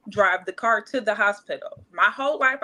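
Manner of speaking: normal talking voice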